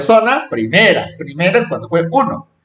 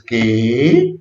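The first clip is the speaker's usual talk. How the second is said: "qué" stretched low, then rising sharply; questioning; 5 semitones lower than usual. Shown as a demonstration. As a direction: ask a drawn-out, rising "qué". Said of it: "cuando es una pregunta".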